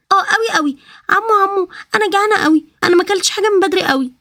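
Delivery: put-on high, excited little girl squealing over the car. Little girl thrilled by the car, high childlike voice.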